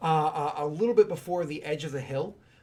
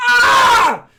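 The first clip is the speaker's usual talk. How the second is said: sudden very high, loud scream; high-pitched cry of pain; whimpered scream; 20 dB louder than usual. Acted. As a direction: shriek as the blade presses in. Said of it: "like, a whimpered scream".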